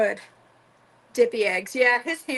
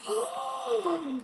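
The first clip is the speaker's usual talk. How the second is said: delighted gasp; 11 semitones higher, 7 dB quieter than usual. Real, not acted. Tasting cashew milk foam.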